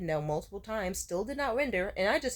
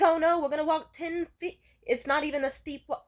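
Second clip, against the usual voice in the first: high, loud mocking wail; mock panicking; 8 semitones higher, 6 dB louder than usual. Mocking the date's fear of ten feet, panicky. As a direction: exaggerate the date's worry. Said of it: "Stop panicking".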